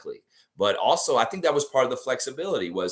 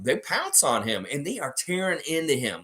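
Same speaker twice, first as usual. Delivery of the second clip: ordinary talk, nothing marked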